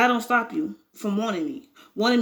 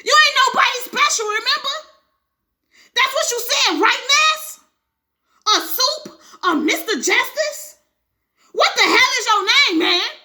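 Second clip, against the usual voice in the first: much higher, much louder mocking shriek; shrieking fury; 12 semitones higher, 6 dB louder than usual. Wife mocking husband's pride, shrieking. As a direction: shriek the insults at him.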